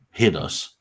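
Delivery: ordinary talk, nothing marked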